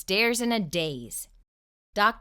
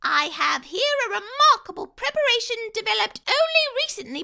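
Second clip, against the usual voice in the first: high, loud salesman pitch, racing at the end; high mouse salesman; 6 dB louder than usual. Acted. Dentist tricking the fox, high pitch voice. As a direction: sell it like a squeaky huckster.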